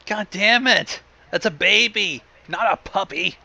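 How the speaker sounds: plain reading voice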